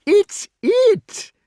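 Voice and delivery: very high, loud, gleeful shout; shouted in high delight. A narrator's reading. King hearing his beast coming, very high delighted shout. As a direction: yelp with joy. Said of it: "he shouted in high delight".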